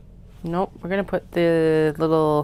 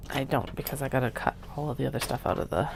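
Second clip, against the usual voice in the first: ordinary talk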